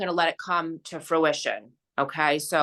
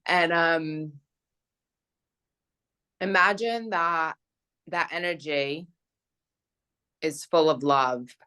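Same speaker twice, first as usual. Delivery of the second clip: slow, calm guiding voice with long pauses; guided meditation; 2 semitones lower, slower than usual. Acted. Friend guiding a closing energy visualization, calm meditation voice. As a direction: gently lead them through the visualization.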